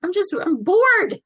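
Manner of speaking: hammed-up bored whine, stretching out "bored"; Missy. Fan imitating the villain's explanation, exaggerated boredom. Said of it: "I love her explanation of that".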